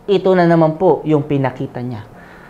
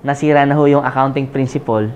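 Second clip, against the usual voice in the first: ordinary talk, nothing marked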